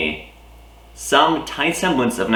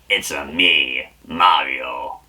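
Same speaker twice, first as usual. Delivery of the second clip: low, slower Wario growl of the catchphrase; 7 semitones lower, slower than usual. Retold or imitated. Slipping from Mario into Wario, a low Wario voice. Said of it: "I went into Wario voice there".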